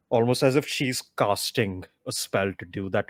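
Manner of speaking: normal talking voice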